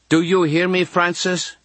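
high, insistent nun demanding an answer; Sister Rita. A narrator's reading. Nun to girl caught talking, insistent. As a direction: press the child for obedience. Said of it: "then Sister Rita's voice".

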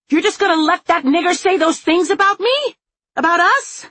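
loud, very high furious demand; high-pitched enraged shouting; outraged yelling. A narrator's reading. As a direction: shriek it at a parent in disbelief. Feral daughter confronts her father.

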